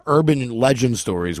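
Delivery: ordinary talk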